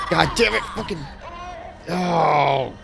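sudden panicked yell; startled scream. Real, not acted. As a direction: scream in fright. Jump scare in horror game.

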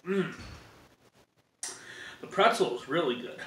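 chewing while talking; talking between bites; mouth full of pizza. Real, not acted. Seen 0: Bites pizza just before; holds slice at mouth, chewing while talking.

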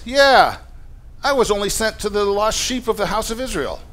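high, hammed-up agreement with the disciples; mock-agreeing satire, exaggerated and high; satirical. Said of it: "into a satire".

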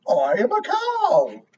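cow voice. A narrator's reading. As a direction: give the cow a gentle, different voice. Cow speaks in story.

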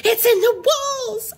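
high, drawn-out storybook screech; high-pitched, frantic cry. A narrator's reading. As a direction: screech it, stretching "walls". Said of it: "Owl screeched".

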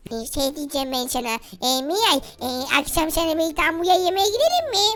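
very low, soft, hesitant character voice; bashful suitor. Pretend. Tombiş asking the girl to dinner, bashful. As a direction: ask haltingly, dropped low and quiet.

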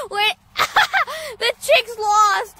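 high squealing laughs; laughing. Real, not acted. Amused at lost chick.

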